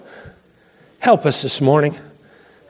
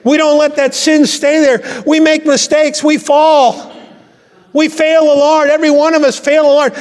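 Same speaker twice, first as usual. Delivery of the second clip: fervent preaching; 10 semitones higher than usual. Real, not acted. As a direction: lift the congregation in full preaching fervour. Preaching builds to full force.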